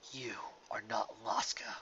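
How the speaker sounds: slow, hushed, crying voice; whispered in disbelief; broken whisper. Acted. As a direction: barely get the words out through tears. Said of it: "It was all he could manage to say".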